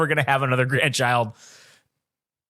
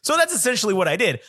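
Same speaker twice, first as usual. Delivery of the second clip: ordinary talk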